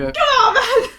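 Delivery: high, loud complaint; exasperated protest. Real, not acted. Player protesting the game master's line, high and loud. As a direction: cry out in exasperation.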